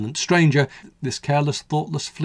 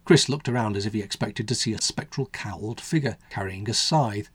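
usual speaking voice

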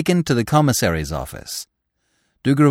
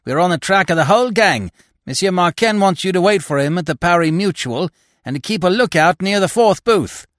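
hurried report, pitched high and louder; 8 semitones higher, 4 dB louder than usual. A narrator's reading. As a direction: rattle off urgent news in a high voice. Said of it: "said to him hurriedly".

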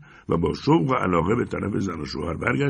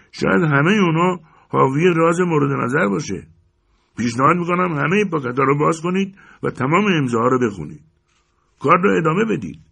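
higher, louder jeering call; shouting; 8 semitones higher, 5 dB louder than usual. Acted. A man in the crowd shouts a mocking suggestion. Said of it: "یکی فریاد کشید".

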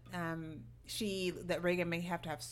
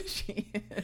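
laughing. Real, not acted. Laughing agreement.

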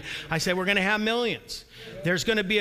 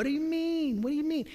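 mocking whine; 7 semitones higher than usual. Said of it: "God's mocking them and saying".

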